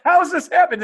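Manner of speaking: high, loud comic wail; hammed-up tearful bewilderment; mock crying. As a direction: wail it like a client in tears. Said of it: "And they're crying because".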